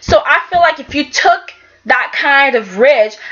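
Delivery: ordinary talk, nothing marked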